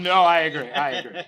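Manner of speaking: laughing, loud and high. Real, not acted. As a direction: laugh through the agreement. Laughing agreement with colleague.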